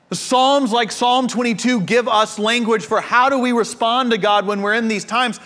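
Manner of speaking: ordinary talk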